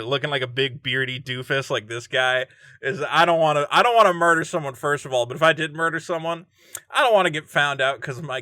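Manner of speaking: ordinary delivery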